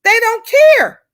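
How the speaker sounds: loud outraged cry. Real, not acted. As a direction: throw it out in disbelief. Outraged rant peak.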